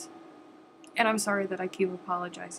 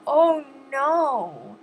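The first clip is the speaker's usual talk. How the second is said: mock scolding; 6 semitones higher, 7 dB louder, much slower than usual. Teasing viewers who haven't thumbed up.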